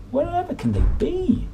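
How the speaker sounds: very high anxious cat voice; worried Flabby Cat. Acted. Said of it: "said the Flabby Cat".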